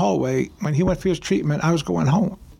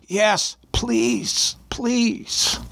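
high-pitched retold pleading; desperate plea; 6 semitones higher, much slower than usual. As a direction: imitate a desperate man begging from behind a curtain. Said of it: "somebody sobbing".

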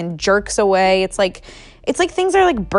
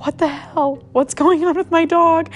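high, rising alarm; startled cry at the sick dog; 9 semitones higher than usual. Retold or imitated. To her dog behind the door, high alarmed. Alarmed at dog's retching noise.

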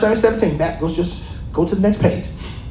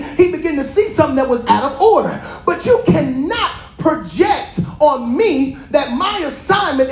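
a lightly raised preaching voice; preaching rebuke; 6 semitones higher than usual. Acted. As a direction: press the point home on the listeners.

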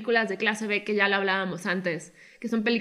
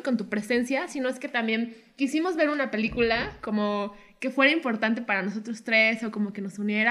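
ordinary delivery

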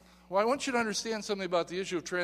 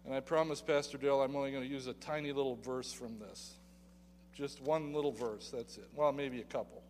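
quiet aside; 4 semitones lower, 6 dB quieter than usual. Real, not acted. Joking aside to the pastor.

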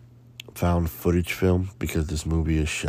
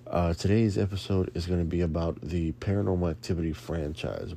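usual speaking voice

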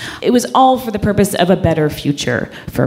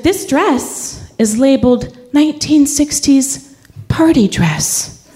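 higher, slow, lightly voiced scolding boss; condescending boss; 8 semitones higher, slower than usual. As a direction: talk down to her slowly. Boss lecturing about labels.

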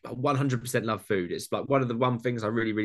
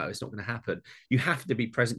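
ordinary talk, nothing marked

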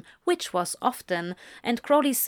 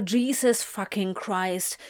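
ordinary delivery, nothing marked

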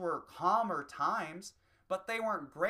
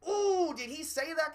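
high, loud mock-offended gasp; 12 semitones higher, 7 dB louder than usual. A shocked prude hearing an edgy joke, loud high gasp. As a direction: play a clutching-pearls listener, high and loud.

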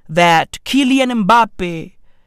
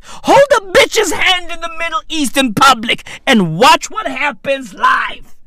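raised warning; 6 semitones higher than usual. Real, not acted.

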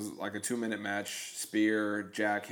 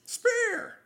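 high excited wrestling yell; hype shout; 6 dB louder than usual. Acted. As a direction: shout it like an announcer.

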